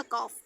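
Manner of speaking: "कॉफ" with no l before the f; silent L. Shown as a demonstration. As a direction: say "कॉफ", vowel straight into f. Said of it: "तो एल silent है".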